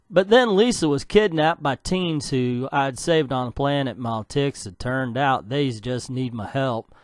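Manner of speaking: put-on country storyteller; hammed-up country yarn-spinning; country accent. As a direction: tell the recap in a country accent. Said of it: "I started a country accent".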